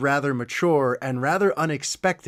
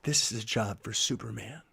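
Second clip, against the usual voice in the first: low, hushed near-murmur; quiet, sincere; 6 semitones lower, 10 dB quieter than usual. Retold or imitated. Daughter turns to dad during the movie, quiet and sincere. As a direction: say it softly, fully meaning it. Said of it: "turns to me quietly and says".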